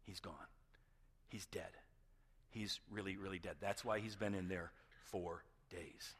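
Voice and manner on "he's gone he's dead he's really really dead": much softer, lower, giving up; hushed resignation. Retold or imitated. Mourners on the fourth day, quiet and defeated. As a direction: let it sink in quietly. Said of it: "Fourth day".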